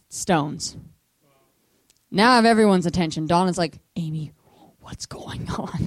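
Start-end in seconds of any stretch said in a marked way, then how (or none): 3.9-5.8 slow, hushed whisper, acted; breath-only imitation of a friend's question; whispered bewilderment. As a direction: whisper it as a worried visitor. Donna's hushed bedside question.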